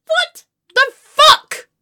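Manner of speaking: loud, high, slow word by word; appalled outburst. Acted. Aghast at having said she loved it, appalled outburst. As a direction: punch each word in disbelief. Outburst at own false compliment.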